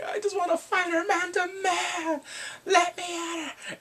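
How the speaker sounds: high-pitched hammed-up wail; mock crying. Jailed man begging to fight her, mock crying. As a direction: wail it out, stretching the last words. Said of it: "he's crying".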